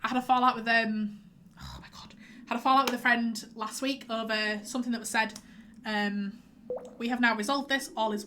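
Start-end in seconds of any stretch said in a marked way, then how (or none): 1.6-2.1 breathy whispered aside; a real startled whisper; whispered oh my God. Real, not acted. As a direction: drop to a whisper under your breath. Sudden aside mid-sentence.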